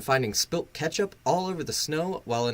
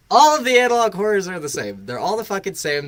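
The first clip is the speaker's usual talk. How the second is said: heated rant; 6 semitones higher, 8 dB louder, faster than usual. Real, not acted. Heated rant about analog horror.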